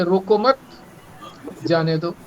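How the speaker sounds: "रोको मत" as one phrase with "मत" rising, then a pause before "जाने दो"; pause after mat. Shown as a demonstration. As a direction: hold the break after "मत". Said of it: "रोको मत के बाद कॉमा लगा दिया". ("मत" के बाद ठहराव, फिर "जाने दो")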